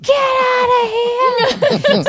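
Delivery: high, slow, crying shout to flee; panicked scream. Retold or imitated. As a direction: scream it out in terror. Retold panic during earthquake.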